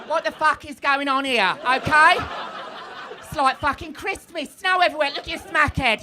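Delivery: loud Essex police captain; high, punchy Essex accent on a drug bust; broad Essex, bawling out a suspect. Acted. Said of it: "but the captain's from Essex".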